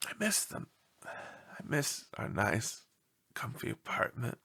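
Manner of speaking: a homesick dog, whispering; wistful whisper. Acted. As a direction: whisper it sadly. Lonely dog misses home.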